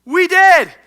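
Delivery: high, loud admission; emphatic shout. Real, not acted. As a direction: belt it out to the congregation.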